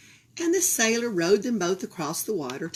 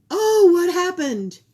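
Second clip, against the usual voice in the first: high, loud surprised cry; alarmed gasp; 11 semitones higher, 11 dB louder than usual. A narrator's reading. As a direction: gasp out the question in shock. Narrator reacts to the fall.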